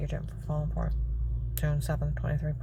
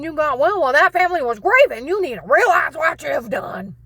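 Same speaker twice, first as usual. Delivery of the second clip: higher, loud accusing rant; angry rant; 7 semitones higher, 8 dB louder than usual. Retold or imitated. Agent accusing her of faking sickness, angry and loud. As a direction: build the accusation hotly.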